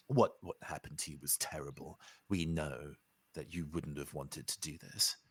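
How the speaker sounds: soft, low, tender reassurance; gentle comfort. Acted. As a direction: speak softly to someone hurt. Character comforts a returned friend.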